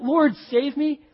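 loud, high cry for rescue; yelling for help. Acted. Peter sinking in the waves, yelling to the Lord. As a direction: yell it out as you sink. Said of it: "yells out".

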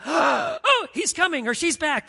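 high, loud alarmed hiss of a warning; lookout warning. Retold or imitated. Spy at the gym door warning classmates the teacher's back, urgent and high. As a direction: sound the alarm to your classmates.

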